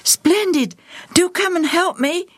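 put-on high, breathless boy's voice; panting. A narrator's reading. Joel asks the children to join the chase, panting. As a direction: urge them on, puffing and high. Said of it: "Joel panted".

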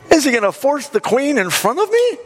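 high-pitched, affronted question; really bothered king. Acted. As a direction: act out a king's scandalized outrage. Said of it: "now he's really bothered".

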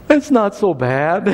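imitating a hurt boy's slow, brave consolation; slow, lightly put-on crying child's voice; tearful son. Injured son consoling a crying little girl, lightly mimicked tearful voice. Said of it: "he walks over and he goes".

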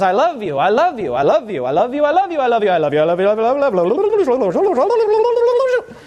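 repeating mechanically, speeding up; malfunctioning robot loop. Pretend. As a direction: glitch like a stuck machine. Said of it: "And then it says".

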